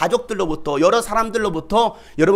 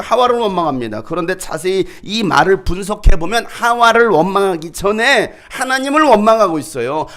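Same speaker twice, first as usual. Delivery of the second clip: normal talking voice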